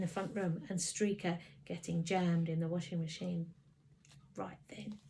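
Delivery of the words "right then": soft hissing whisper; angry mum hissing at her son; hissed whisper. A narrator's reading. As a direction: hiss it under your breath. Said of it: "she hissed".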